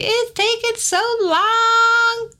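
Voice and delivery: put-on high, slow childish whine; whiny kid. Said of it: "Kids always say".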